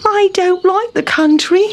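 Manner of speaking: high-voiced grumbling Town Mouse; put-on unhappy little mouse; sighing complaint. A narrator's reading. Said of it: "sighed Town Mouse".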